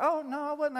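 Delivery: a lightly voiced caught-out Sarah; Sarah denying. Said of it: "she's like".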